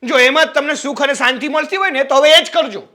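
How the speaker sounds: sarcastic angry outburst. Acted. Quarrel ends in sarcastic outburst.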